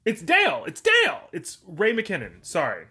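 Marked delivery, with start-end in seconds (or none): 0.1-0.6 higher, louder, rising "It's Dale."; excited realisation. Real, not acted. Realising the name, first take. 0.7-1.2 very high, loud "It's Dale." falling at the end; triumphant shout. Real, not acted. Repeating name even louder.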